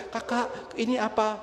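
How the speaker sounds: high-pitched puzzled wife; istri yang bingung. Retold or imitated. Wife notices her waters breaking. (suara istri bingung, tinggi)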